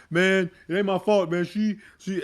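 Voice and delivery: put-on a mad twelve-year-old pleading his case; a boy blurting out it's not his fault; upset boy. Angry boy voiced in story.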